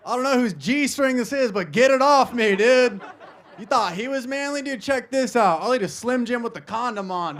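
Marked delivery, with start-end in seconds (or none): none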